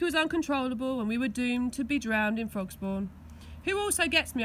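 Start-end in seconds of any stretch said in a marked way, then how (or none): 3.6-4.4 grumbling. A narrator's reading. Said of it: "I grumbled".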